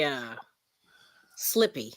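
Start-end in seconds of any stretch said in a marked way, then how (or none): 1.4-1.9 "slippy" said in place of slippery; Pittsburgh accent "slippy". Shown as a demonstration. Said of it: "They say".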